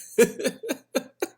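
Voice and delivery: big high burst of laughter. Real, not acted. Laughing at the friend's blunder, high burst. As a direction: crack up loudly.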